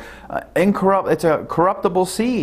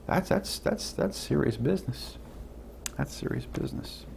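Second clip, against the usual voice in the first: hushed; 13 dB quieter than usual. Real, not acted. Preacher to congregation, dropping to a whisper. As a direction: lean in and whisper how serious it is.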